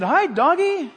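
put-on high, loud little-girl greeting; little granddaughter. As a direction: call out brightly to a dog like a small child. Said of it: "and she said".

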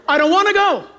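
mock reluctant complaint; reluctant whine. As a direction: protest like a husband dragged shopping. Husband's own protest in the anecdote.

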